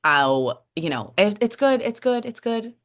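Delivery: high, forced brightness covering pain; fake cheerful. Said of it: "I would say".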